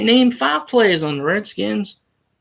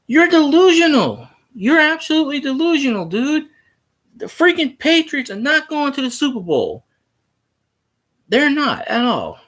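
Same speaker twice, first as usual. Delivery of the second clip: much higher, heated scolding of a commenter; exasperated rant; 9 semitones higher than usual. Real, not acted. Streamer scolds a Redskins fan in chat, heated and much higher. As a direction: tell him he's delusional, all fired up.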